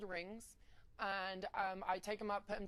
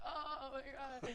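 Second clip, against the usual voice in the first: very drawn-out, higher mock groan; dazed moan; 5 semitones higher, much slower than usual. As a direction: imitate moaning in pain, stretched right out. Retells being hurt, dragged inside.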